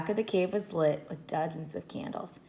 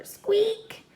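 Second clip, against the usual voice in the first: a tiny high squeak like a mouse. A narrator's reading. Narrator squeaking for Annie's pet mouse, high squeak.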